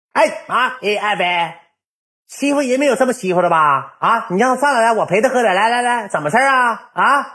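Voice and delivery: 高八度的怒气质问; 像气急的媳妇那样尖着嗓子嚷; 尖声发火. Retold or imitated. 爱妃发火质问. (媳妇被欺负上门时高声嚷嚷)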